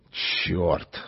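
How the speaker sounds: low, soft hiss; hissed curse. Acted. The cowed strongman hisses a curse, low and soft. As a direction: spit it under your breath. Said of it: "прошипел он".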